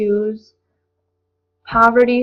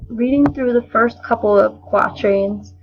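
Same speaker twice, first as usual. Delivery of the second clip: normal talking voice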